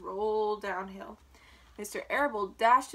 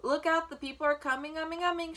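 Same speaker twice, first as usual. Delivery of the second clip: higher, urgent warning call; storybook shout, pitched up; gander's shout; 5 semitones higher, 3 dB louder than usual. A narrator's reading. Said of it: "shouted the gander".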